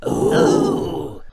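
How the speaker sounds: very high impressed ooh; impressed goblins. A narrator's reading. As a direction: coo in wonder like dim goblins. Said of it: "said the goblins".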